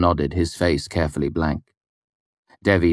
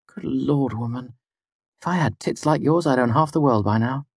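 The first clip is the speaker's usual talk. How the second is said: high woman's exasperated marvelling; irritated envy; 8 semitones higher than usual. A narrator's reading. Devi envies Fela's figure, irritated and high-voiced. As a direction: exclaim high in envious exasperation. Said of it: "shook her head with irritation".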